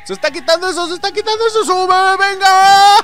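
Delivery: high, building to a long loud scream; frantic urging. Real, not acted. Player pushing the cart uphill, frantic high screaming.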